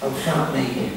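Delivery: low, quiet self-doubting mutter; a hint of the negative voice in your head; inner critic voice. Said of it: "them two little voices".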